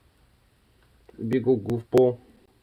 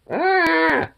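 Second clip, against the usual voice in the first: startled yelp; 5 dB louder than usual. Real, not acted. Pet grabs at owner's ear.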